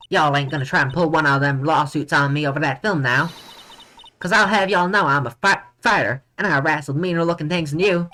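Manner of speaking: indignant Southern countrywoman; hammed-up Southern telling-off; Southern accent. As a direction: scold in a put-on Southern accent. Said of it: "I can't do a southern accent".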